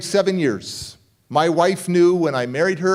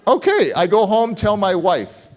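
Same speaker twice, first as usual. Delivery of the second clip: usual speaking voice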